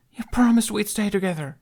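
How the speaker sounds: put-on high, hurt little boy; pleading child voice. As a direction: plead like a betrayed kid.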